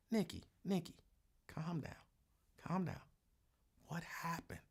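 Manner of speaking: hushed, gentle coaxing; quietly calm a crying friend down; softly coaxing a sobbing coworker to calm down; soft soothing. Retold or imitated. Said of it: "I'm like".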